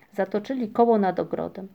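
ordinary delivery

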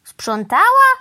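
loud, high, rising disbelief; astonished echo, pitch jumping up; exclaimed question. A narrator's reading. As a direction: cry it out, incredulous. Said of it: "wykrzyknął pan Wrona".

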